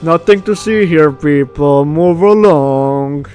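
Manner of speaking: mock crowd-dispersing call, drawn out at the end; casual laid-back guy. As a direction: playfully echo him, stretching out the last word. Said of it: "being the casual, laid-back guy".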